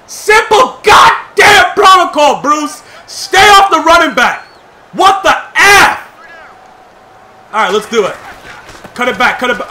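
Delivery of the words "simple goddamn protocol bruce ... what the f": slow, loud, higher furious scolding; angry shout. Real, not acted. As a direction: chew out the teammate. Scolding teammate Bruce.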